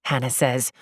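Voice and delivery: ordinary delivery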